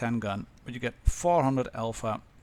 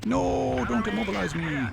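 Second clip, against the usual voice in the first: high, sudden alarmed protest; alarmed cry; 9 semitones higher than usual. Real, not acted. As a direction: yelp it as the tank stops. Getting tracked in battle.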